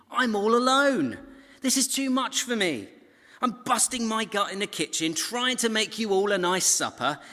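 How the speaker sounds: high and loud exasperated rant; furious overworked hostess; blowing her top. Acted. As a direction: vent like a sister fed up in the kitchen. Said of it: "she's blowing her top right now".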